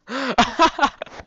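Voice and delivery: high, loud cackling laugh; burst of laughter. Real, not acted. Player amused by the grim pill label, laughing loudly. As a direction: crack up in a high-pitched burst.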